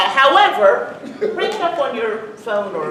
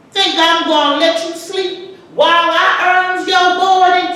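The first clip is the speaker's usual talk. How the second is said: dialect poem recital; 5 semitones higher than usual. Acted. Grandmother reciting Dunbar, a mother hollering her son out of bed. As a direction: belt out the morning call in dialect, fed up. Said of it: "recite the words to this poem".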